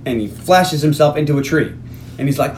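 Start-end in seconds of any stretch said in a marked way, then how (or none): none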